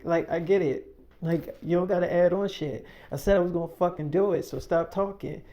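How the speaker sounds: loud heated rant; angry. Retold or imitated. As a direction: tell her off, furious and done. Heated argument between friends.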